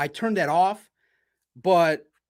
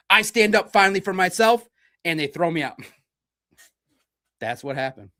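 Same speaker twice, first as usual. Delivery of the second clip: ordinary talk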